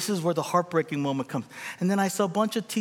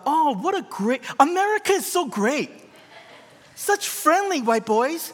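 high-pitched mock-innocent gushing; naive delight; 10 semitones higher, 4 dB louder than usual. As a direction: gush like a wide-eyed newcomer, voice way up. Naive childhood thought, acted out.

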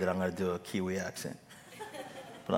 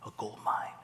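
awed whisper; breathy gold mine whisper; 2 semitones lower, 6 dB quieter than usual. Retold or imitated. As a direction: hush it like treasure. Churches marveling at insurance.

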